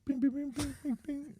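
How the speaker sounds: very high, soft bings; imitated spoon banging. Lady tapping the spoon, high soft bings. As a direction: mimic the tiny spoon taps. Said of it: "she goes".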